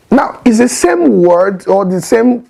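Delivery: ordinary delivery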